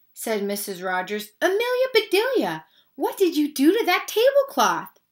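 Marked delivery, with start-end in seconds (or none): 1.4-5.0 high-pitched exasperated demand; exasperated scolding. A narrator's reading. Missus Rogers confronts her maid about the tablecloth, exasperated.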